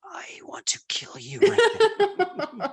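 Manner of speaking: very high, slow laughing whisper; seething whisper. Retold or imitated. Fuming designer wanting to kill his mentor, mock seething whisper. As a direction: hiss it out half joking.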